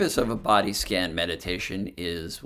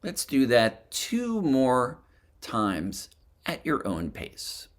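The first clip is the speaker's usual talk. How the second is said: guided meditation; 3 dB quieter than usual. Acted. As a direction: gently lead them through a body scan, phrase by phrase. Leads a breathing and body scan meditation.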